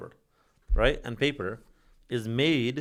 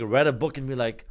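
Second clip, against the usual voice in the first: normal talking voice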